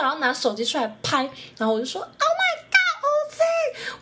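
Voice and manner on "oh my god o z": much higher, a squealed Oh my God; fan scream. Retold or imitated. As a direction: shriek his name like a starstruck fan. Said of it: "大尖叫". (激动地尖叫偶像名字)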